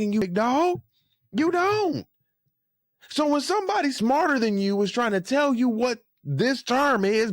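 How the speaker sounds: high-pitched, incredulous telling-off; exasperated scolding. Real, not acted. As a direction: scold him directly, pitch riding high. Scolding absent Ish in disbelief.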